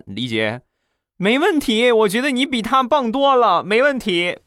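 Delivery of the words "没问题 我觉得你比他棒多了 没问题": much higher, eager female voice; eager woman. Retold or imitated. Neighbor woman eagerly praising the narrator, much higher voice. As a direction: gush your approval as the neighbor. Voicing Sister Li's eager reply.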